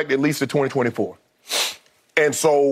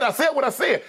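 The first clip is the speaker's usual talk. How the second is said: animated quote; 8 semitones higher than usual. Retold or imitated. Emphatic laughing quote of LeBron.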